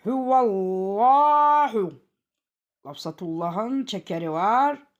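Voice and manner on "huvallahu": "Huvallahu" chanted slowly with a heavy, dark lam; thick lam recitation. Shown as a demonstration. Teacher showing the rule to students, "Huvallahu" with thick lam. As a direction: recite "Huvallahu", louder, with a long thick "llaa". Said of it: "kalın okunur".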